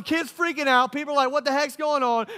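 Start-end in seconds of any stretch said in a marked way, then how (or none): 1.3-2.3 alarmed bystander. Acted. Crowd reacts to kid freaking out.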